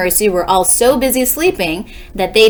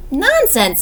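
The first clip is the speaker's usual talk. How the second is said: unsure, higher-pitched denial; frightened; 8 semitones higher than usual. A narrator's reading. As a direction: dismiss it with a touch of fear. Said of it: "He sounded frightened".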